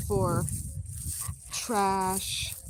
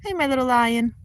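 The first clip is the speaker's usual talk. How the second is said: cooing pet voice; sweet-talking the cat; 6 semitones higher, 7 dB louder than usual. Real, not acted. Owner cooing to her cat in pet voice. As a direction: coo at your little lion.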